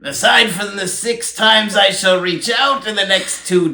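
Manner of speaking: put-on high, slow old British town crier proclamation; hammed-up old British herald reading a decree; mock town crier British accent. Hosts mock the breakup text as a town crier proclamation, high and pompous British. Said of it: "the British accent that [name] was doing".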